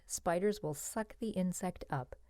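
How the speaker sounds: plain reading voice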